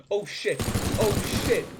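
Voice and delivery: loud, high exclamations; alarmed cry. Real, not acted. Player reacting to sudden danger, alarmed shout.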